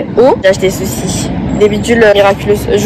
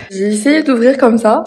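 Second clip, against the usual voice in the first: ordinary delivery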